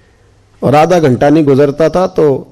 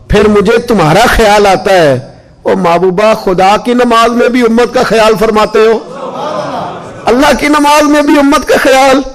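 raised, fervent sermon cadence; devout preaching; 4 semitones higher than usual. Acted. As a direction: preach with love for the Prophet. Preaching with devotion. (भावपूर्ण, ऊँची आवाज़ में तक़रीर)

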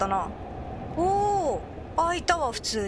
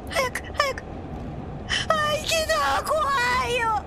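high frantic urging then relief; hit hard crying out; 10 semitones higher, slower than usual. Real, not acted. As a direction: cry out in a panicked rush. Rushing past the monster. (焦って叫ぶ)

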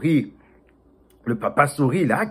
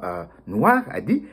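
plain reading voice

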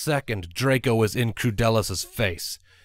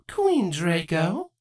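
higher, slow call of a name; 8 semitones higher, slower than usual. Acted. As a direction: call out to the queen, drawn out. Character calls out.

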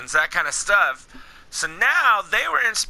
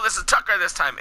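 ordinary delivery

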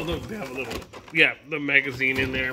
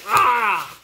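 high sharp excited shout; 7 semitones higher, 5 dB louder than usual. Real, not acted. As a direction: yelp with delight. Excited exclamation.

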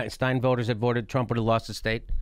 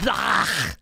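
disgusted ugh. Pretend. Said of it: "a dumb guy like me looks at you and goes".

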